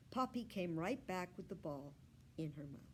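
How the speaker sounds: plain reading voice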